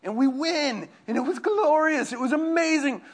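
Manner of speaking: high, loud cry of glory; triumphant. Retold or imitated. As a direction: shout out the win in triumph. Relives the winning moment.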